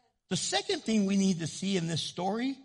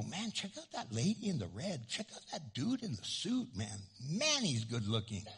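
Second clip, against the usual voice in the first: much softer, low murmured remark; hushed aside; 7 semitones lower, 11 dB quieter than usual. Retold or imitated. A man murmuring to a friend about a woman, hushed. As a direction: say it under your breath to a friend.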